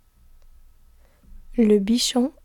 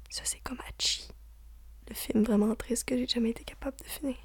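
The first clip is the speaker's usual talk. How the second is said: soft unvoiced whisper, quick; 13 dB quieter, much faster than usual. Real, not acted. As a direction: breathe it out as a hushed aside. Whispered aside in a list.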